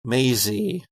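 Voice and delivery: ordinary talk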